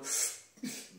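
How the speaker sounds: put-on sharp breathless gasp of a startled kid; little boy gasping before agreeing; small boy's gasp. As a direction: suck in air like a scared little boy. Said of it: "little boy me going".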